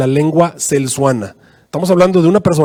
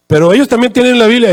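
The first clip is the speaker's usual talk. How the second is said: higher, mildly insistent objection; protesting; 7 semitones higher, 3 dB louder than usual. Retold or imitated. Secuba argues back to the angel, mildly protesting. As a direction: push back a little, pitched up.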